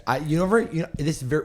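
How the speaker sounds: usual speaking voice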